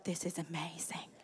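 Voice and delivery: hushed, whispered wonder imitating a rapt husband; awed whisper. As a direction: whisper it as if enthralled mid-show. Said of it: "And he's like".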